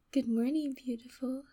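ordinary delivery

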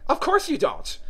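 high, loud dismissive retort; contemptuous. A narrator's reading. Hatter scorns Alice's ignorance of time, contemptuous. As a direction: toss it off with lofty contempt. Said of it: "tossing his head contemptuously".

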